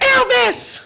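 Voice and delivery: put-on high, squeaky kid answering teacher; child's voice. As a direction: pipe it up like an eager child. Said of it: "second grade classroom".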